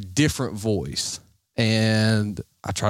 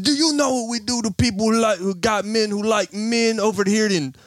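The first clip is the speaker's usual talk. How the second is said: put-on high, accented scammer rant; mock threatening scammer; 10 semitones higher than usual. Scammer threatening the prankster, high imitated accented rant. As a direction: menace him in a mock foreign accent, pitched up. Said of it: "laying into me pretty bad".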